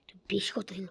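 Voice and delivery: whispered jab. Real, not acted. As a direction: hiss it under your breath. Mutters at a mob.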